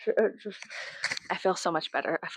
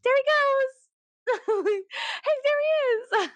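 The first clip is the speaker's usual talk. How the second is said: very high, slow, louder gleeful call; excited delight; 4 dB louder, much slower than usual. Retold or imitated. Spotting the man on her feed, a high gleeful call. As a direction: cheer him on, delighted. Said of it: "I'm like".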